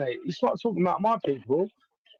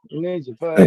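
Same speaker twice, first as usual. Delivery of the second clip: louder repeat; 2 semitones lower, 9 dB louder than usual. Real, not acted.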